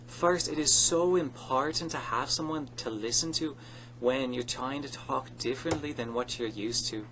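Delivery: ordinary talk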